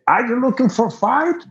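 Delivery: high-pitched imitation of a trucker; challenging trucker. Trucker confronting the musician in a bar, high challenge.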